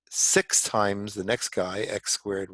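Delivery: ordinary delivery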